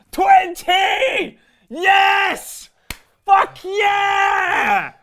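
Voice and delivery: high, jubilant yells; triumphant shouting. Real, not acted. Host celebrating twenty comments, high triumphant shouts. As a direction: shout out the victory, stretching the words.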